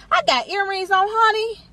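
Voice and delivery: high, proud showing-off; sassy and pleased with herself; sassy boast. Real, not acted. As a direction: flaunt it, honey.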